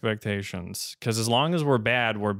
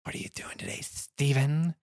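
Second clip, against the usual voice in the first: hissing, whispered snake voice; drawn-out s like a snake; 4 semitones higher than usual. Retold or imitated. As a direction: slither the words out like a snake. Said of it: "he sounds like a snake".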